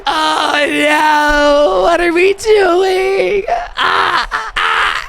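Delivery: high, stretched wails of real despair; anguished cry. Real, not acted. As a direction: cry out as the season dies. Team loses the game.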